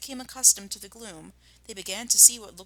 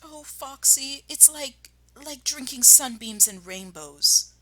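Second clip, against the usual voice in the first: slow, higher blissful voice; rapturous Badger; 6 semitones higher, 5 dB louder, slower than usual. Badger telling Foxy how the cider tastes. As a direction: savor every word dreamily.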